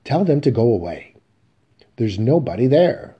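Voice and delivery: urgent pleas to send the visitor away; the woman. Acted. The woman voiced, agitated.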